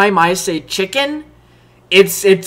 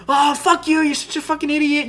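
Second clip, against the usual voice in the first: higher-pitched mock annoyance; angry and annoyed; 7 semitones higher than usual. Girl stops the game on him, retold annoyed outburst. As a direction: imitate an exasperated girl's insult. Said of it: "she gets super angry and annoyed".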